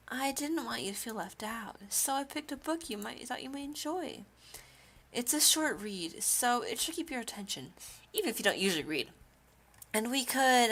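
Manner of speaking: put-on soft, halting shy girl voice; shy Yuri. Shy girl lending a book, soft and hesitant. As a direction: offer it quietly and hesitantly.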